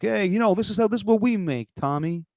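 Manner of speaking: high-pitched Italian-American accent, hammed up. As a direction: mimic an Italian-American father in an ad. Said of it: "his actual dad. He's like".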